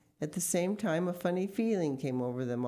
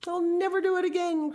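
loud, high-pitched promise; frantic high pleading; desperate cry; 13 semitones higher, 7 dB louder than usual. A narrator's reading. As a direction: swear it in a panic, voice way up. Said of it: "cried Mister Gregg".

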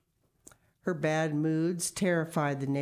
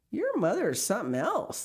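admiring; 7 semitones higher than usual. Acted. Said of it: "they told him admiringly".

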